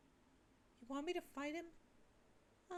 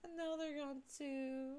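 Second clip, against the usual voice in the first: normal talking voice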